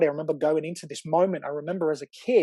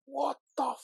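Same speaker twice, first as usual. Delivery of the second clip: shocked question; 9 semitones higher, 5 dB quieter than usual. Retold or imitated. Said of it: "and I went".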